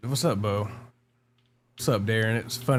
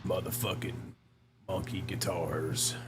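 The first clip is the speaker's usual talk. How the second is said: monster voice; 3 semitones lower, 7 dB quieter than usual. Acted. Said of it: "I left my monster voice on".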